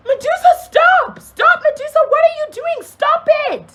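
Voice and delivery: high, loud retold screams; panicked high-pitched shouting from across the park; frantic yelling. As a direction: shriek at someone to stop, as an imitation. Imitates someone screaming to stop fight.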